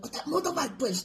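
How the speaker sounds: a hint of a Chinese accent, retold; imitated Chinese accent. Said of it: "Chinese lady".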